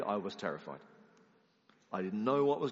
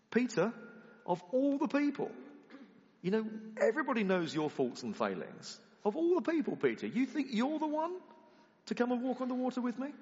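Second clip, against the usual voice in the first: mock incredulous taunt; 12 semitones higher than usual. Imagined taunt continues.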